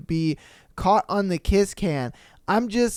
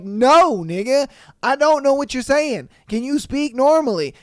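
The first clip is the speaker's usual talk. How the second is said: mock exasperation, pitched high and loud; higher, louder frustrated comeback; exasperated retort; 7 semitones higher, 5 dB louder than usual. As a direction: act out a fed-up date, voice raised. Retold exasperated date snapping back.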